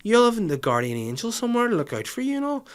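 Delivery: high, soothing mock motherly voice; hammed-up comforting mum; imitating mum. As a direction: coo it like a mum reassuring a stranger. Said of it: "my mum was like".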